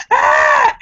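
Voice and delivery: short, high mock scream; startled scream. Telling of spotting a raccoon, mock scream. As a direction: re-enact a startled shriek, high and loud. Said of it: "And I screamed".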